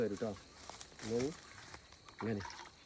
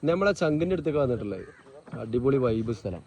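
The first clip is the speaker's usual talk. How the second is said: malayalam english mix; 13 dB louder than usual. Acted. Loud vlog host intro.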